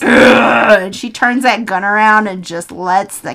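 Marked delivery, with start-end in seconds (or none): 0.1-0.8 loud mock growl of exasperation; imitated snapping "ugh"; exasperated growl. Heidi snapping at the taunting cat, loud mock growl.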